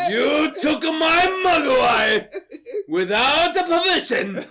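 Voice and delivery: put-on high, loud, slow Chinese-accented wail; hammed-up lament in a fake Chinese accent; mock Chinese accent. Chinese man reproaching Billy's family, wailing mock Chinese accent. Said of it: "the Chinese man's like".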